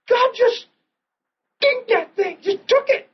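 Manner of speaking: high, tearful voice cracking with emotion; crying. Retold or imitated. Retelling being prayed over, crying out in high tearful sobs. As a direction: sob the words out, overwhelmed. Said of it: "I'm bawling".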